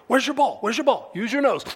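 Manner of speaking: re-enacted eager pet commands; excited dog talk. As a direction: rile the dog up to hunt.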